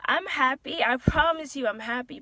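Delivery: high, loud and welling up; tearful insistence. Real, not acted. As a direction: insist on it through real tears. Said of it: "I don't know why I'm tearing up".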